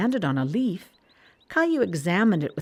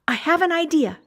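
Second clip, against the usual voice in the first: put-on high, louder girl's idea; Sarah exclaiming to Caillou, high and louder; excited exclaim; 11 semitones higher, 5 dB louder than usual. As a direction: bubble over with a new idea. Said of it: "Sarah exclaimed".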